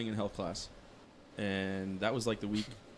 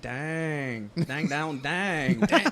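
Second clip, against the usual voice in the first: twangy "Dang, down, dang" getting louder into a laugh; 5 semitones higher, 6 dB louder, much slower than usual. Shown as a demonstration. Showing friends what badangadangs sound like, twangy and building to a laugh. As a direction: pluck out each "dang" like a banjo twang.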